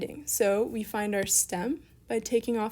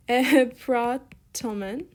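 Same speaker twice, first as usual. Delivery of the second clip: slow, louder, stretched "Epratomen"; syllable by syllable; 2 semitones higher, 6 dB louder, much slower than usual. Shown as a demonstration. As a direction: sound out each piece deliberately. Writing out the form slowly.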